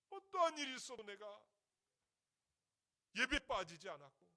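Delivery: high, loud lament to God; crying out resentfully. Retold or imitated. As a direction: imitate a desperate, reproachful prayer cried out loud. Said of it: "하면서 막 울부짖는 데 있잖아요".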